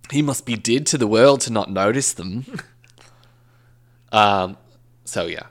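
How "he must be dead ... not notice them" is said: put-on Australian accent. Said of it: "So the boyfriend said".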